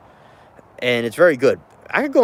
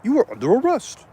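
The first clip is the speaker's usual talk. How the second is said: police officer; 8 semitones higher than usual. Retold or imitated. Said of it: "the police is like".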